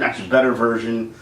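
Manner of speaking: ordinary delivery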